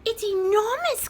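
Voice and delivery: high, clucky hen voice; young chicken clucking. Acted. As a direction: cluck it out in wonder. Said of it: "clucked the younger chicken".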